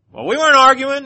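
high, loud indignant denial; imitating her protest to the officer; mock defensive retort. Woman answers the officer.

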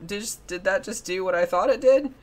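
double-checking what was just seen; mildly startled, retold disbelief; retold double take. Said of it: "for a second, I was like".

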